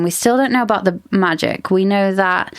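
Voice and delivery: ordinary talk, nothing marked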